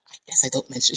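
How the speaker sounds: very soft, private murmur; whispering to herself. Acted. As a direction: say it under your breath, to yourself. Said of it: "and then whispers to herself".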